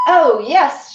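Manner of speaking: ordinary delivery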